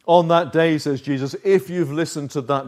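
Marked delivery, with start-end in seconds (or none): none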